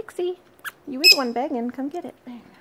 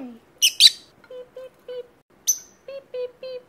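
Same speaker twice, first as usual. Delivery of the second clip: high beeping; 13 semitones higher, 9 dB louder, much slower than usual. Retold or imitated. Imitating bird sound.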